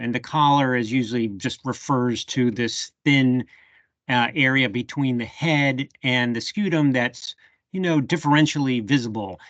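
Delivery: ordinary talk, nothing marked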